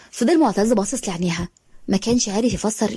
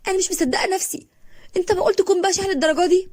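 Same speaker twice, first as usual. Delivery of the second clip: pitched high, close to crying; tearful disbelief; 9 semitones higher than usual. A narrator's reading. Dahab to Nasser, crying in disbelief. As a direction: heartbroken and stunned. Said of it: "وعيطت وقالت له".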